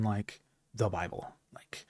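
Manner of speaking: a soft unvoiced whisper; whispered aside. Real, not acted. Naming the one book above Berserk, whispered aside. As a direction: drop to a quick whisper, like a reverent aside. Hushed aside about the Bible.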